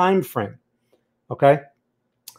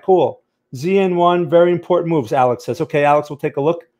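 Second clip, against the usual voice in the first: usual speaking voice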